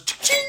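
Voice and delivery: imitated till sound, punched out; cash register cha-ching. Celebrating a billion dollars, cash register cha-ching. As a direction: mimic a ringing cash register.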